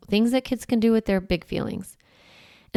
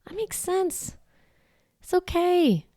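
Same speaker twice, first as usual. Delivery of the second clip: higher, much softer and slow; soothing reassurance; 11 semitones higher, 7 dB quieter, slower than usual. Shown as a demonstration. Parent comforting an upset child, soft, higher and slow. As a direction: coo it to a distressed child. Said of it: "one of my favorite phrases".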